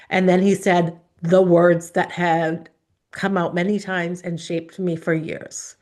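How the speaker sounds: ordinary delivery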